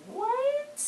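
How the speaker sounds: very high-pitched, drawn-out disbelief; shocked squeal. Retold or imitated. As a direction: let the shock shoot way up high. Shocked reaction to the hint.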